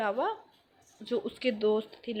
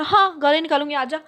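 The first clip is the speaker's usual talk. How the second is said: loud, higher dare to fight; retold defiant dare; 4 semitones higher, 10 dB louder than usual. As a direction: throw the challenge back, loudly. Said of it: "मैंने".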